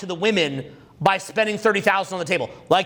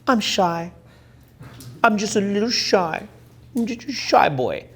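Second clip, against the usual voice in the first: put-on shy boy. Shown as a demonstration.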